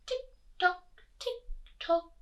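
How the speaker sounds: high, evenly spaced ticks; little high clockwork voice; ticking clock. A narrator's reading. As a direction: imitate a clock, beat by beat. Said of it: "went the living room clock".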